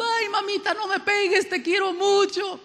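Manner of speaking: put-on high, whiny child begging his mom; crying little boy. Preacher voicing himself as a boy begging his mother not to hit him, high whining plea. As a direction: plead like a kid dodging a spanking. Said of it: "le iba llorando".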